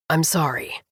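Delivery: soft, dejected apology; sorry said downcast and quiet; bummed. A narrator's reading. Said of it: "looking so bummed".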